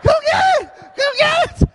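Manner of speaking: high, loud shout of his name; wife yelling. Retold or imitated. Wife shouting for Eric to come get the fish, very high shout. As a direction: yell for him to grab the pole. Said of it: "She goes".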